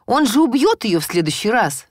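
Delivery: отчаянный крик, выше и громче; кричит, требуя понять. A narrator's reading. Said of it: "закричала я". (громкий отчаянный крик)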